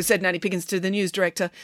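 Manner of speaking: usual speaking voice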